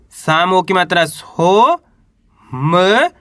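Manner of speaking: drawn-out syllable, rising on सो; slow sounding out. Shown as a demonstration. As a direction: stretch it slowly like a phonics drill. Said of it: "मिलाएंगे तो सोमनाथ". (सो पर सुर उठाकर धीरे से बोलना)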